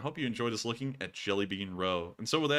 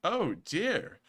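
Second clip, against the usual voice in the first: surprised; 9 semitones higher, much slower than usual. Real, not acted. Reacting to news that the government resigned.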